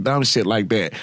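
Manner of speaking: usual speaking voice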